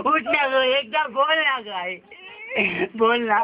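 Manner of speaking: slow, crying outburst; sobbing shout. Acted. Son sobbing at his mother's body, begging her to speak.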